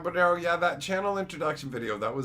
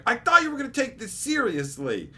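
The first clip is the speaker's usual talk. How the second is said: higher, louder scolding mother; angry mom; 9 semitones higher, 5 dB louder than usual. Retold or imitated. Mom scolding her son over his intro video, higher and louder. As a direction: scold him like a let-down mom. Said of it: "she got really mad at me".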